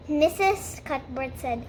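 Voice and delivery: usual speaking voice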